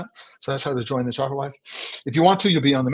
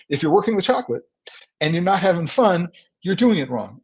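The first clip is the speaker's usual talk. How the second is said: higher, louder and more upbeat; energetic; 6 semitones higher, 3 dB louder than usual. Shown as a demonstration. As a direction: say it with fresh energy, like a slogan. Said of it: "Let's do this".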